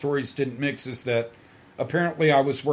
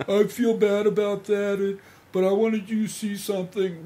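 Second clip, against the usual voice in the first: higher-pitched mimicked female voice; imitated woman pleading; 8 semitones higher than usual. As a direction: voice her apology in a raised pitch. Voices the woman in his story.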